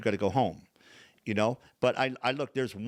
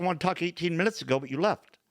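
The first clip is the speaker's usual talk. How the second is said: pitched up, pointed retort; retold indignation; 7 semitones higher, 3 dB louder than usual. Patient answering the therapist who walked out, indignant. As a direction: throw it back at him, high and put out. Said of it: "I'm pissed off about what you did".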